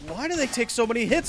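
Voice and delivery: normal talking voice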